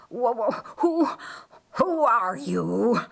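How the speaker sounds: stuttering, shaken; wounded Lucifer stammering at his attacker; dazed stammer. Acted.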